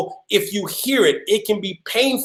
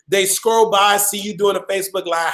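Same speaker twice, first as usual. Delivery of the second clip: normal talking voice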